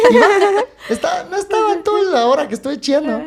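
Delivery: high, loud burst of laughter into the words; cracking up, pitch jumping high; laughing. Real, not acted. Laughing as he tells friends the dog came down.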